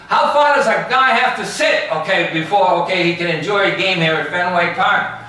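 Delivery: high, loud mock outrage; retold angry yell. Fan hit on the head yells at the ballpark, high indignant yell. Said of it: "he yells and screams".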